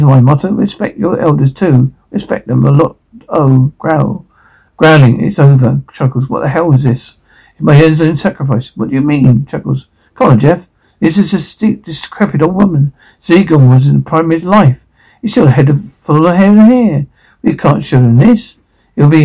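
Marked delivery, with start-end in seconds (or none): none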